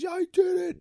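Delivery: high, loud Rocky imitation; Rocky's triumphant cry. Fan reenacting Rocky's big moment, triumphant high yell. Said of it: "when he goes".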